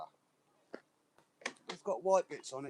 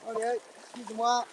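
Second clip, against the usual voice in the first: calling out; 9 semitones higher, 8 dB louder than usual. Real, not acted. Calling out to passers-by.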